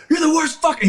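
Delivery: very high, shouted insult; cussing out. Retold or imitated. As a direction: imitate a commander tearing into his driver. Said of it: "cussing him out".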